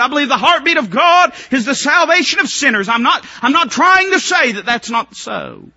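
loud, high revival preaching; fiery pulpit preaching; preaching at full force. Acted. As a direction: preach it hard to the congregation.

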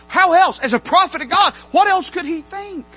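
loud, high, ringing sermon peak; preaching at full force. Acted. Preacher declaring God spoke through the donkey, at full force. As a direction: shout the truth from the pulpit.

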